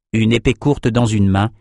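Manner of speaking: ordinary delivery, nothing marked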